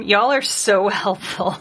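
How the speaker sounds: sarcastic thanks through a laugh. Real, not acted. Streamer teasing chat for useless suggestions, laughing sarcastically. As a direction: laugh while mock-thanking them.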